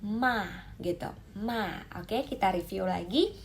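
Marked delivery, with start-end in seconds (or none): 0.0-0.5 MA thrown downward; "Ma" as if tossing it away; falling fourth tone. Shown as a demonstration. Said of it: "seperti kayak kita buang sesuatu". 1.4-1.8 "Ma" with a falling pitch; falling fourth tone. Shown as a demonstration. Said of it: "seperti kayak kita buang sesuatu".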